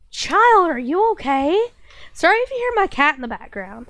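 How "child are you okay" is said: pet talk. Real, not acted. Streamer talking to her cat.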